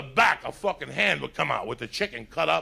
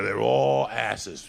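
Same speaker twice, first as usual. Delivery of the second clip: slow punchline; 4 semitones lower, much slower than usual. Real, not acted. Punchline about yoga class.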